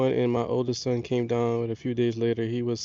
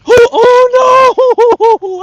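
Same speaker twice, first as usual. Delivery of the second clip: high, loud panicked "oh no"; dismayed shout; 20 dB louder, much slower than usual. Real, not acted. Shouting as his first crappie gets away. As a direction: cry out as the fish gets away. Losing a fish.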